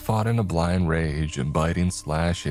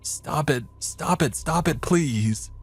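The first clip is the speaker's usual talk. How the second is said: high, desperate crying plea; tearful pleading; 10 semitones higher than usual. Acted. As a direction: beg through tears, voice breaking. Crying, begging the voices.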